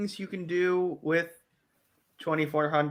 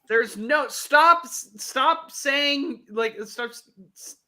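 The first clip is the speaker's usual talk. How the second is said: shouting match; 9 semitones higher, 9 dB louder, slower than usual. Pretend. Mock scolding, stop saying.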